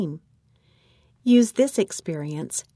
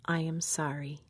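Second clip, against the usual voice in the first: soft, lowered voice, near a whisper; whispered apology; 3 semitones lower, 8 dB quieter than usual. A narrator's reading. Shamed attendant apologizing to patient, hushed. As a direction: murmur the apology very quietly. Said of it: "Then he whispered".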